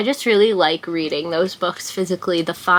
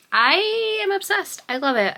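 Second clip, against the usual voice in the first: gushing; 12 semitones higher than usual. Real, not acted. Gushing about the book.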